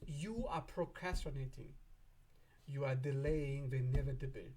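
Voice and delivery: ordinary talk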